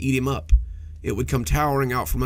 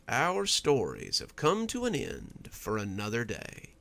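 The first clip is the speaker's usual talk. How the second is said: slow, much softer, hushed outro; spooky host sign-off; 5 dB quieter, slower than usual. Acted. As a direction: ease listeners out with a creepy calm. Host Doctor Plague signs off.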